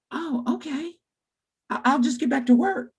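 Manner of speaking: put-on high, meek little kid voice; small high voice, readily giving in; imitated pupil. Johnny agreeing with his teacher, high mild child voice.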